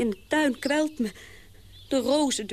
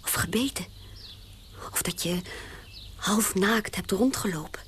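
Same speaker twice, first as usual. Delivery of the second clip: hushed unvoiced listing; whisper; 4 semitones lower than usual. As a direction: whisper each odd craving. Whispered strange cravings. (fluisterend, opgewonden)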